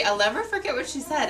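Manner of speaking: ordinary delivery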